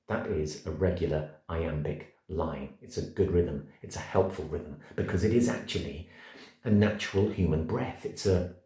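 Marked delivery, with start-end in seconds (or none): none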